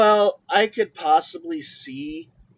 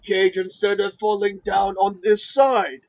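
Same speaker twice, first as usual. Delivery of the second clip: stomp through it like a giant; Khali voice; 3 semitones higher, 5 dB louder than usual. Retold or imitated. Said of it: "Khali impression".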